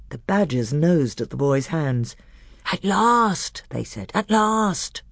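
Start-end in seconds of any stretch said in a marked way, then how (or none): 2.7-3.6 high, hushed badger relief. A narrator's reading. Badgers greeting the boys at long last, in high relief. 4.1-5.0 high, hushed badger relief. A narrator's reading. Badgers greeting the boys at long last, in high relief.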